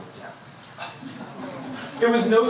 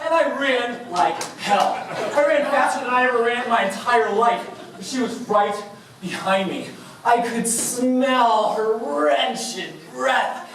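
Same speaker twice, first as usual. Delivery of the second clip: plain reading voice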